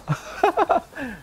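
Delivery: quick squeaky giggle at the horse's name; high giggle. Real, not acted. Gamer giggling at his own horse's name, high and light. Reacts to naming horse Nobu.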